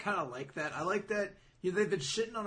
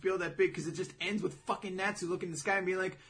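ordinary delivery

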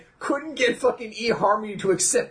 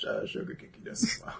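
whispered; 12 dB quieter, faster than usual. Real, not acted.

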